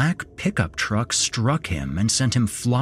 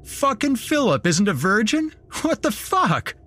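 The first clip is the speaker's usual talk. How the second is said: high, incredulous jock voice; amused jock; 14 semitones higher than usual. Acted. Idiot jock mocking the victim's claim, amused high disbelief. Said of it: "He laughed".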